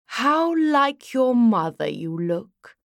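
slow, languid male elf voice; elegant elf sigh. A narrator's reading. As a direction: draw it out like a wistful lord. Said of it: "it sighed".

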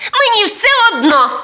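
very high, fast, loud squeal; high-pitched bratty outburst; defiant imp. A narrator's reading. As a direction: snap back as a naughty little devil. Imp defies the boy.

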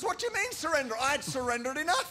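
mock indignation. Mock-arguing with Jesus.

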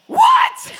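very high, loud yelp of disbelief; shocked shriek. Retold or imitated. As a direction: shriek it in sudden horror. Reacting to missing passport request.

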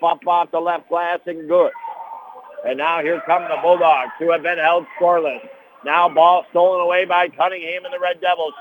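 brisk running game commentary; play-by-play call. Real, not acted. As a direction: call the action as it happens. Live basketball play-by-play call.

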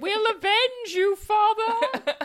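squeaky high vow of revenge; hammed-up child crying out, laughing. Voicing giraffe's children.

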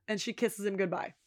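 usual speaking voice

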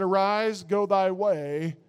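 slow, hushed words of Jesus; quiet, slow and reverent; solemn quote. Retold or imitated. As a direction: let it fade to a hush at the end. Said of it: "He said".

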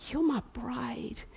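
slow soft whisper; hushed loving whisper; tender whisper. Acted. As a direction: whisper it with love. Jesus voiced tenderly.

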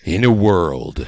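deep, slow, loud trailer announcer; low, drawn-out epic voiceover; movie trailer voice. Pretend. Podcaster auditioning for Hollywood, deep slow trailer voice.

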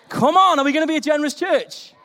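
high, loud call to the crowd; preacher urging the church. Real, not acted. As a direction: rouse the congregation. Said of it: "urging congregation to respond".

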